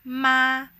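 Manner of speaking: "ma" held high and flat; first tone. Shown as a demonstration. Teacher demonstrating the first intonation, "ma" high and flat. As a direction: keep "ma" on one high, level pitch. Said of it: "a very high and flat pronunciation".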